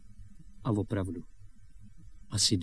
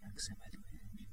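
very soft, voiceless aside; covert whisper; 22 dB quieter than usual. Acted. Boy secretly asks his friend's role, whispering. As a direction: whisper so the grown-ups won't hear.

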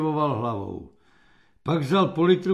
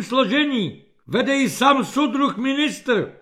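high, raised voice full of alarm; extremely agitated; 6 semitones higher, 5 dB louder than usual. A narrator's reading. Captain warns the major of an inspection, agitated high voice. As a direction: deliver the bad news in high agitation. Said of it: "krajně rozčilený kapitán Ořech".

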